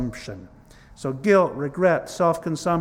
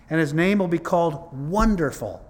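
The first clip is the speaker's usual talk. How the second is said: scripture reading; 3 semitones higher, 6 dB louder than usual. Acted. Reads Isaiah aloud in sermon.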